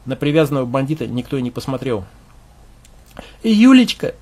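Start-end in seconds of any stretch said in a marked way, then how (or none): 3.4-4.1 high, loud sobbed name; loud sobbing call, much higher; sobbing cry. Acted. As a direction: cry her name out in grief. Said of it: "вновь всхлипнул".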